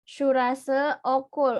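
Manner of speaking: "Surase okul" said very slowly and clearly; drawn out for students to write down; slow classroom dictation. Shown as a demonstration. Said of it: "repeats the example for dictation".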